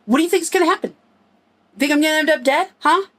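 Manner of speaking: put-on a teenager's fast, high comeback to mom; angry teen yell. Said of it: "I walked up to my mother and I went".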